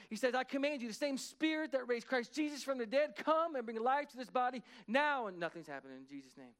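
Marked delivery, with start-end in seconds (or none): 0.3-5.3 loud, high, driving prayer of command; retold minister praying at full force. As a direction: build to a forceful final order. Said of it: "He's still commanding".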